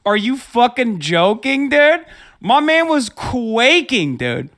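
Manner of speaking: incredulous, slow, rising rant; heated rant. Real, not acted. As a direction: build the indignation word by word. Ranting at the debate opponent.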